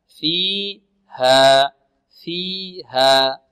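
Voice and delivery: فيها drawn out very slowly to show the two counts; slow demonstration. Shown as a demonstration. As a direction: stretch فيها slowly so they hear the length. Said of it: "لاحظتم الآن؟". (نطق بطيء لتوضيح المد)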